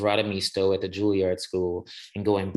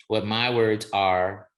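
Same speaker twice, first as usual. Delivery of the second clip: ordinary delivery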